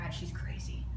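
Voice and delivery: hushed, breathy gossip aside; imitating strangers whispering that she's crazy; mock whispered judgment. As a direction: whisper it like people talking behind her back. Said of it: "people are like".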